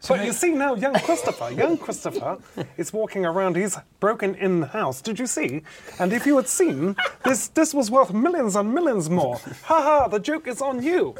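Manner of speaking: halting, lisped, pompous boxer impression; Chris Eubank. Impressionist performing the boxer for the panel, mannered lisping voice. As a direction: address someone grandly, stretching the words. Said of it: "my one with Chris Eubank".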